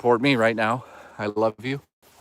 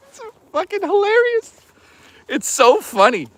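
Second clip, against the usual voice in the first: laughing; 4 dB louder than usual. Real, not acted. Laughing at own joke.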